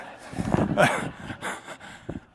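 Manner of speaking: short breathy laugh at his own joke. Real, not acted. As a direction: laugh off the joke before taking it back.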